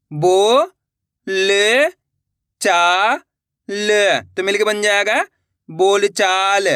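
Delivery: slow, careful syllable dictation; slow spelling. Shown as a demonstration. Teacher sounding out a word for students, slow and careful. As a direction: sound out each syllable slowly, then blend. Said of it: "देखो किस तरीके से".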